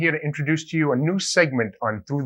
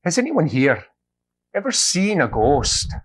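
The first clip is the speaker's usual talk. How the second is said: priest voice; 6 semitones higher, 3 dB louder than usual. Acted. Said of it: "priest steps up and he says".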